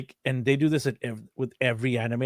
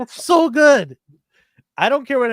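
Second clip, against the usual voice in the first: much higher and louder, gushing; gushing excitedly; 12 semitones higher, 9 dB louder than usual. Real, not acted. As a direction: rave about it. Gushing about the anime.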